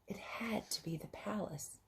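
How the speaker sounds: soft storybook whisper. A narrator's reading. Narrating for children, suspenseful whisper.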